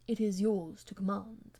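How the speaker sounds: a lightly voiced cool nobleman; reserved gentleman. A narrator's reading. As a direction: answer her with restraint. Percy's reserved reply.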